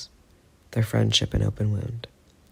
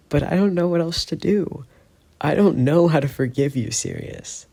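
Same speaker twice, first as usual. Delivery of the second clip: voice cracking; 6 semitones higher than usual. Acted. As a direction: let the voice crack as he begs. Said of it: "His voice cracks".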